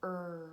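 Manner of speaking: "Er" as one held R sound; letter sound demo. Shown as a demonstration. Teacher reviews letter sounds with children, held "Er" sound. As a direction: hold the R sound of "Er" steadily. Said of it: "just gonna say the sound it makes".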